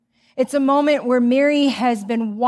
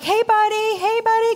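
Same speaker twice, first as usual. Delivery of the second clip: put-on calling out for her toddler; 10 semitones higher than usual. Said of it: "So I'm like".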